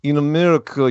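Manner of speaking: "In America" with a hammed-up Russian accent. Shown as a demonstration. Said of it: "I'm gonna do it in my Russian accent".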